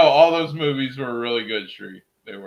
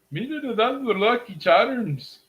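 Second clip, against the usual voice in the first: silly cartoon voice; 8 semitones higher than usual. Mock cereal ad voice.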